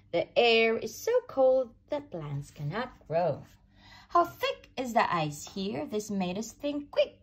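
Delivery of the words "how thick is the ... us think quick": usual speaking voice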